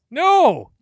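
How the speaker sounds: loud, high outburst; one big high-pitched NO; shouted no. Acted. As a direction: shout a sudden refusal. Blurted shout within banter.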